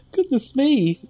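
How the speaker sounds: real emotion rising into a high, choked voice. Real, not acted. Streamer overwhelmed by childhood game memories, choked up. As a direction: fight back happy tears, high-pitched, with teary laughs. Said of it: "I'm getting choked up".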